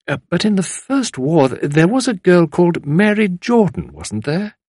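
lightly voiced lady venturing a question; Tuppence prompting. A narrator's reading.

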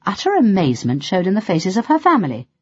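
plain reading voice

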